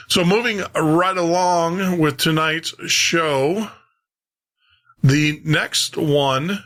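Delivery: show announcer. Acted. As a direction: present the next show like a radio host. Announces the next radio show.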